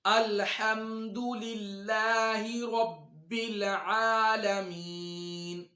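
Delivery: slow, melodic tajweed recitation, drawn out; slow Quranic recitation. Shown as a demonstration. Teacher reciting slowly for students to follow. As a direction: recite each word slowly for students to repeat. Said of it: "सूरतुल फातिहा को देख लेते हैं".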